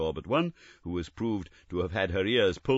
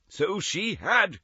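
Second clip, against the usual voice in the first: high, slow, fervent cry; cried out; 11 semitones higher, slower than usual. Acted. Scrooge to the ghost about his sister, crying out high. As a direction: Scrooge eagerly agreeing. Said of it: "cried Scrooge".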